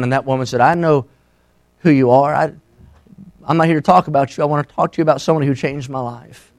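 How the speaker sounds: soft, low retelling with halting pauses; hushed sermon storytelling. Real, not acted. Preacher retelling a church lady's words to an outcast, hushed storytelling. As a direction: gently voice the story, keeping it quiet.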